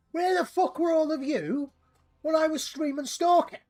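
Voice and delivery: hammed-up fake fury at absent viewers; mock outrage. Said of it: "No, I'm not taking the piss now".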